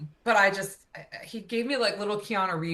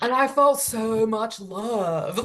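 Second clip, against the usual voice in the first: slow, dreamy surfer dude; 4 dB louder, slower than usual. Retold or imitated. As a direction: stretch it out, blissed-out surfer. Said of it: "has the craziest surfer voice".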